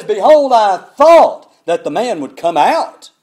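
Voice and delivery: loud, slowed, indignant complaint; angry shout. Retold or imitated. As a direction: spit it out like a proud man insulted. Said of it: "Now he's mad".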